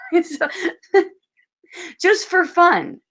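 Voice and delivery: slow, laughing through the words. Real, not acted. Teacher laughing that it is all just for fun. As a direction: let the laugh carry the line.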